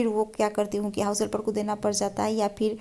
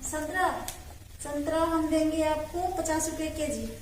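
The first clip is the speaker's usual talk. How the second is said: vegetable seller voice; 6 semitones higher than usual. Pretend. Vendor haggling with customer.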